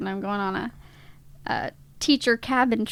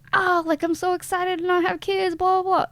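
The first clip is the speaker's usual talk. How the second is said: mock excited coworker; 8 semitones higher than usual. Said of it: "they're all like".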